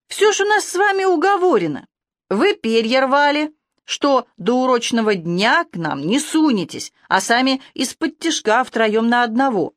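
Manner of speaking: put-on high, indignant young falcon voice; falcon prisoner. A narrator's reading. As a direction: pitch it up high as the accusing bird.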